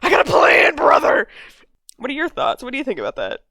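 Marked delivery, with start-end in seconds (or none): 0.0-1.3 loud, high-pitched character shout; mock-dramatic cry to a brother; shouting impression. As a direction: call it out like an over-the-top schemer. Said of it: "he's like".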